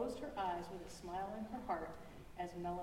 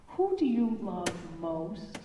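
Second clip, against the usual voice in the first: put-on child asking; 9 dB louder, much slower than usual. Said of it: "shrieked Max, didn't shriek".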